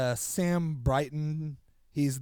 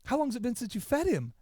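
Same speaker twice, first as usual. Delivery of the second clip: quoted question; 9 semitones higher than usual. Retold or imitated. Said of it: "He goes".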